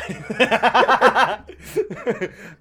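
loud, high laughing fit; real helpless laugh; big burst of laughter. Real, not acted. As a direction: crack up out loud. Laughing at beer joke.

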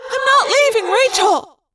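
high, defiant cry; cried out. A narrator's reading. Cassie to the others, defiant cry. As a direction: refuse to leave her friend. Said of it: "Cassie cried".

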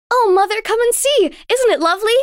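put-on high, eager little girl; a child thrilled by the view; excited child. A narrator's reading. Girl calls her mother to the window, excited. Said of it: "said quickly".

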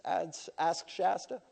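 normal talking voice

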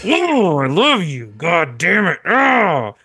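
imitating a dad pretending to swear lovingly; mock-angry loving dad. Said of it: "pretend to be angry, but in a way that was like".

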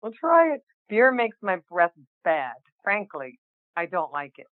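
ordinary delivery

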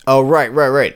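ordinary talk

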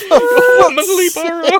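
high, loud real laughing squeal; helpless laughter. Real, not acted. Friend cracking up at an absurd erotic phrase, helpless laughter. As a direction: squeeze the words out through laughter.

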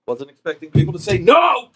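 high mock-outraged yell. Real, not acted. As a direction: shout it across the room. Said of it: "My yelling".

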